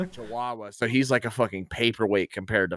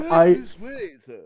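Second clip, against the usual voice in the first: high, prim servant voice; polite butler voice; 6 semitones higher, slower than usual. Pretend. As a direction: usher them in grandly. Ghost plays a polite butler.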